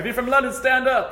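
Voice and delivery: hammed-up preacher calling out; mock preacher. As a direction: call out to the crowd like a preacher. Imitating preacher calling guests.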